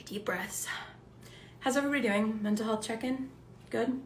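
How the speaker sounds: very soft, calming voice; gentle check-in. Real, not acted. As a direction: check on everyone softly and tenderly.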